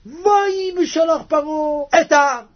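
high, loud crying wail; wailing lament. Acted. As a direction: mourn the loss in a high cry. Pharaoh laments letting Israel go.

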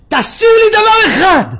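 high, loud, desperate plea; acted pleading shout. Sick man begging the rabbi to leave him one thing, high shout. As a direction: beg at full volume. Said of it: "הוא התחיל לצעוק".

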